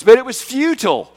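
ringing preacher's punch; emphatic preaching. Real, not acted. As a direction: drive home the futility.

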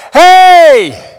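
loud, high, drawn-out holler; hailing shout. Acted. Lepers hail Jesus from a long way off, shouting. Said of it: "some guys hail him".

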